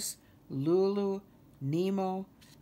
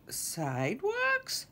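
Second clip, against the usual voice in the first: high, drawn-out disbelief; aghast exclamation; 10 semitones higher, much slower than usual. A narrator's reading. Kermit hears of swamp sidewalks, aghast exclamation. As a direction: stretch it high, like a mock shout. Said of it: "shouted Kermit".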